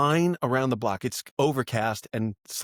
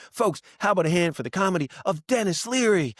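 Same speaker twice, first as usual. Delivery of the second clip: raised emcee voice; stage introduction; 5 semitones higher than usual. Retold or imitated. Opener handing over to the headliner, showy emcee introduction. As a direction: build up to the headliner's name.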